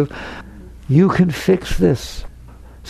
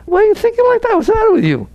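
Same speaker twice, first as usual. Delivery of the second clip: scolding; faster than usual. Real, not acted. Scolding the anxious listener.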